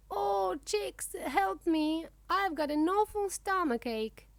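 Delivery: high-pitched cry for help; pained plea. A narrator's reading. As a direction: wail lightly like a dragon with a bellyache. Sick dragon calls for help.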